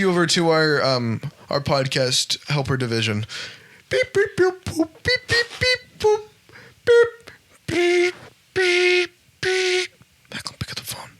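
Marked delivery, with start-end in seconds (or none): 3.9-9.9 high beeping phone tones; beeping phone noises. Acted. Phone transfer sound effect. 10.3-11.1 unvoiced stage whisper. Real, not acted. Aside to co-host.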